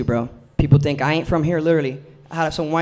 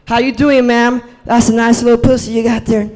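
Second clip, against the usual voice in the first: mock pickup line; 7 semitones higher, 6 dB louder than usual.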